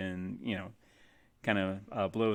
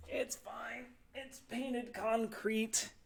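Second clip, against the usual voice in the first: mock reassurance; 13 semitones higher, 10 dB quieter, much slower than usual. Real, not acted. Pieces falling, playing it down.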